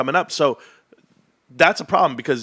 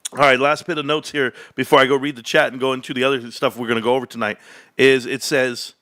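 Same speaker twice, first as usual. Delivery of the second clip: ordinary talk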